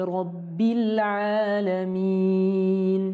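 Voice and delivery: slow, chanted tajwid recitation; measured Quranic chanting. Shown as a demonstration. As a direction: recite it slowly with melody.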